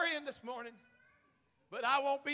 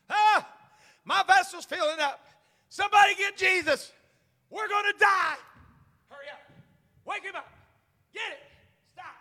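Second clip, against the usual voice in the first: high, loud cries of alarm; acted panic; 10 semitones higher, 10 dB louder than usual. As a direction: play panicked sailors calling for help. Acts disciples panicking in the storm.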